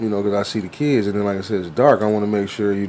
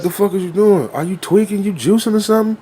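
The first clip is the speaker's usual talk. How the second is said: put-on high, rising kid voice; mock kid cussing; 8 semitones higher than usual. Kid yelling at his friend, in a high mock voice. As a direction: play the little kid getting in his face.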